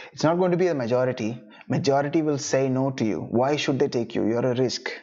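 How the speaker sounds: ordinary delivery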